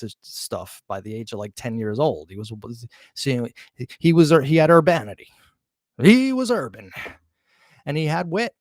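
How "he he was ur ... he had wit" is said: louder and higher, hammering the point; punchy raised voice on "urbanity" and "urban"; emphatic insistence. Real, not acted. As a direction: insist on it, raising the voice. Insisting Berkowitz was urban.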